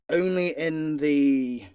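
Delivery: ordinary talk, nothing marked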